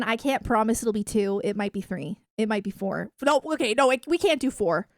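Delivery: ordinary talk, nothing marked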